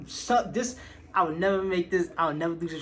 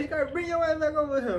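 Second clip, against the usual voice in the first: high, loud shout across the party; loud yell from the back of the room; calling out; 12 semitones higher, 6 dB louder than usual. Retold or imitated. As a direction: holler for him to come back. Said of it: "I hear him in the background".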